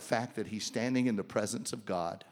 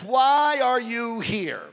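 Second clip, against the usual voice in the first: voice of God; 10 semitones higher, 5 dB louder, slower than usual. Acted. God voiced in retold scripture.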